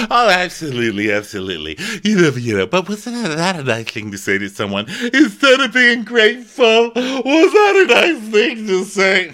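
building outrage. Retold or imitated. Dorinda scolding Brandy at dinner, building to a shout. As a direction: work up into a furious rant.

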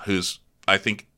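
normal talking voice

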